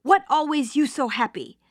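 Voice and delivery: a hint of a Russian immigrant asking, puzzled; a touch of a Russian accent in a plain sermon voice. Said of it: "he said in his Russian accent".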